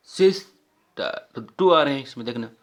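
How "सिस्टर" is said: Sister slowly split as सिस, टर; syllable by syllable. Shown as a demonstration. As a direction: pause between the two syllables of sister. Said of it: "टू बार effort करना पड़ रहा है". (Sister को दो हिस्सों में, धीरे)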